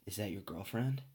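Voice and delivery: half-hearted first stab at an impression; quiet, understated celebrity voice attempt; Kevin Spacey. Podcaster trying out the actor's pickup line, hesitant impression. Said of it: "I'll try and do a Kevin Spacey voice".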